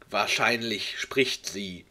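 ordinary talk

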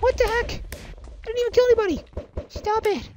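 very high, squeaky protest; indignant squeal. Real, not acted. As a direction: squeal in outraged disbelief. Protesting a hit in game.

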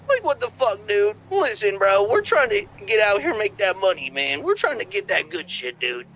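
laid-back Scooby-Doo stoner; Shaggy voice. Acted. New caller passed the phone, as Shaggy. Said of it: "Raggy from fucking Scooby-Doo".

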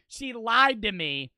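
ordinary talk

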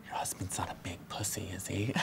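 hushed, breathy aside; whispered subtext. Shown as a demonstration. Trainer whispering the hidden message to the new hire. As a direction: whisper the real meaning like a secret. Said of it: "What we're really doing is saying".

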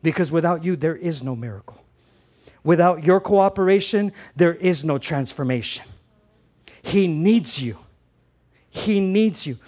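loud, punchy preacher lines with pauses; emphatic preaching. Real, not acted. Pastor urging the congregation they are needed, emphatic and loud. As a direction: press the point home, line by line.

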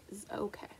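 soft relieved whisper. Acted. Ending the story for the children, a whisper. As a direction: let them know he's fine, hushed.

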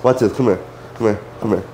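normal talking voice

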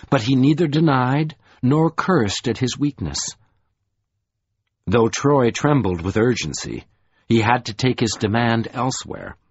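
usual speaking voice

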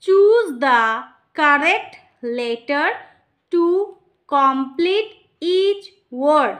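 each word spaced out and stressed, very slow; slow clear instruction. Shown as a demonstration. Teacher reading a worksheet instruction to a child, slow and clear. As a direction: read the task aloud slowly for a child. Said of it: "কিভাবে ইংরাজিতে তাকে জিজ্ঞেস করব".

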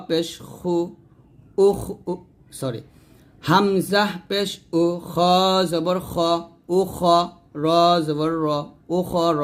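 ordinary talk

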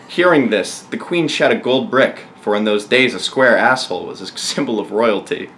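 usual speaking voice

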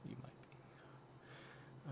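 breathy scared whisper. Acted. Gamer whispering to viewers while hiding.

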